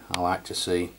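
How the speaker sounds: ordinary talk, nothing marked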